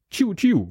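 hohes Laser-Tschiu; Kit-Geräusch. A narrator's reading. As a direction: das Knight-Rider-Auto hoch nachmachen. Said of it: "macht das Känguru". (hohes imitiertes Kit-Geräusch)

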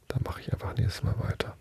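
soft whispered aside; whispering. Real, not acted. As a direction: whisper it to yourself. Whispered aside after reading.

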